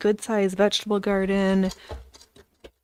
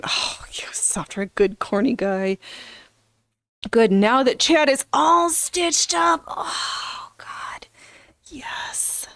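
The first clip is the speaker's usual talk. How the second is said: ordinary delivery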